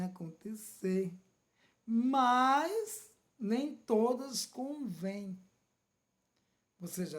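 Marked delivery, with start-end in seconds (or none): 1.8-5.4 "Mas nem todas convêm" slow and louder, every word weighted; slow emphatic quote. Acted. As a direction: lay each word down heavily, like scripture. Quoting scripture, emphatic. (citação lenta e enfática)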